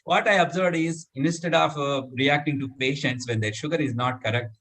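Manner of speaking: usual speaking voice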